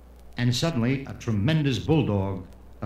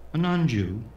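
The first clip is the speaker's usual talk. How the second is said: normal talking voice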